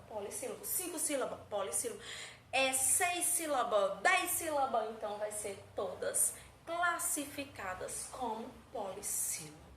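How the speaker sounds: ordinary talk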